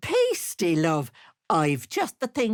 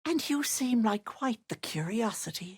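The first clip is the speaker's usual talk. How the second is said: whisper; 2 semitones higher, 4 dB quieter than usual. Acted. Sly whispered aside.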